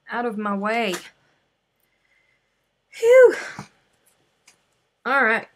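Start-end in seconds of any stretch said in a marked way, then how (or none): 2.9-3.3 big, high blast of relief; much louder, higher sigh of relief; loud relieved phew. Real, not acted. Relief after finishing.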